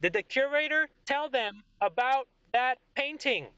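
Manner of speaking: high, haughty royal question; the king quizzes his general; the king asking. Acted.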